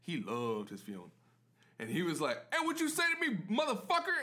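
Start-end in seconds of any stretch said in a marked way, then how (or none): 2.5-4.2 loud, high-pitched mock rage; cussing out. Huge customer cussing out the bartender, mock raging yell. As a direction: imitate a furious man cussing someone out. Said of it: "just cussing me out".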